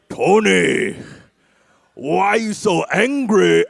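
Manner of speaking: slow, drawn-out mock Japanese accent; exaggerated Japanese samurai father, words stretched out. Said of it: "made him a Japanese samurai".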